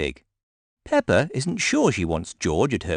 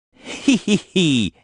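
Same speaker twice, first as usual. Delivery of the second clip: mock giggle, higher; spoken hee hee as a giggle; children laughing; 9 semitones higher, slower than usual. A narrator's reading. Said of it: "everyone laughs".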